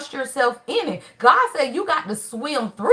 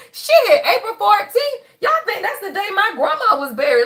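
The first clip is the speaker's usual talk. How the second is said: laughing in disbelief at the coincidence; shocked laughter; 7 semitones higher than usual. Real, not acted. Realizing the date of grandma's burial.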